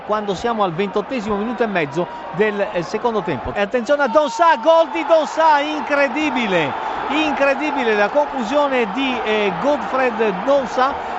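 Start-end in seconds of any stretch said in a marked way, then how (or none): 3.5-11.1 breathless italian goal commentary. Real, not acted. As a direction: build to a shout of disbelief. Commentator calls the go-ahead goal. (radiocronaca del gol urlata, incredula)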